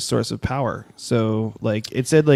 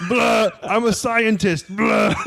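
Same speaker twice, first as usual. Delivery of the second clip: hammed-up puke sound, raised pitch; vomiting; 9 semitones higher, much slower than usual. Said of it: "That's my puke sound".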